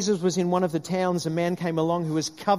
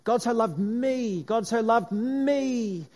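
slow savouring; 3 semitones higher, slower than usual. Shown as a demonstration. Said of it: "we insert our name in there and say".

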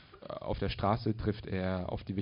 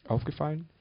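higher-pitched echoed question; puzzled question; 6 semitones higher than usual. A narrator's reading. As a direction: ask it back, pitch lifted. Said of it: "fragte Bob".

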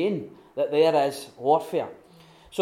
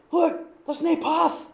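much higher, bewildered voice; puzzled hobbit; 12 semitones higher, 3 dB louder, slower than usual. Retold or imitated. Hobbit at the lost path, high and baffled. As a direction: point and sound baffled.

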